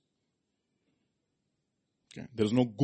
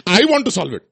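put-on higher, louder insistent child; eager child; 8 semitones higher, 10 dB louder than usual. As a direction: insist like a keen youngster. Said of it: "They say".

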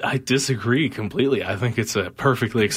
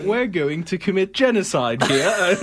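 chipper, higher-pitched British caricature; mock British accent; 5 semitones higher than usual. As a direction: announce it like a cheery Brit, British accent. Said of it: "they say it in that accent".

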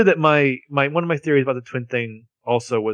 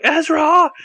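high yelled name, a bit louder; retold yell; 6 dB louder than usual. Friend yelling to Ezra across the rooftop, high alarmed call. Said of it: "Arya's yelling".

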